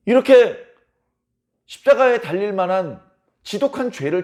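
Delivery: loud, sharp scolding; stern rebuke. Retold or imitated. The other criminal scolding his mocking comrade, loud and stern. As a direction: tell him off hard. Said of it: "그 행악자를 꾸짖습니다".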